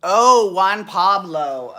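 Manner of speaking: loud, drawn-out cry of recall; sudden realization. Real, not acted. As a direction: blurt it out loudly as it comes back to you. Suddenly remembers the forgotten name.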